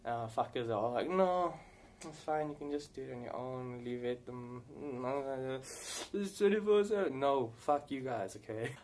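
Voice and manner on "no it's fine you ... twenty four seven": mock brush-off. Player imitating friends who won't join his heists, mock brush-off. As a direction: mimic friends fobbing you off.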